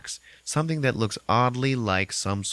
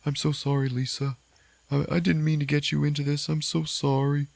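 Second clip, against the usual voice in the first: higher, crying apology; tearful apology; 7 semitones higher than usual. Retold or imitated. James apologizing to Lisa, higher tearful apology. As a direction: plead sorry through tears. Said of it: "he's just like".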